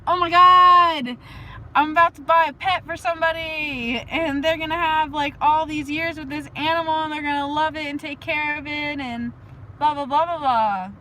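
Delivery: high, loud, giddy gushing; over-the-top delighted rave; gushing happy. Pretend. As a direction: squeal with put-on joy. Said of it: "make myself happy and be like".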